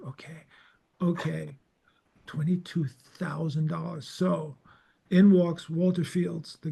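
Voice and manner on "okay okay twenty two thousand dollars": hushed disbelief. Retold or imitated. Digesting the shocking price.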